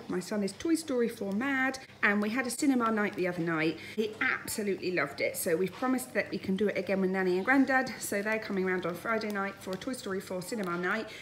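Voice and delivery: ordinary talk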